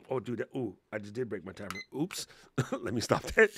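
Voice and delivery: normal talking voice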